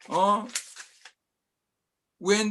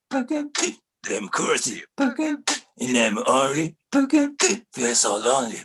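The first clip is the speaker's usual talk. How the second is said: slam poem; 5 semitones lower, faster than usual. Acted. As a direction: spit the words in jagged bursts. Slam poem, spat lines.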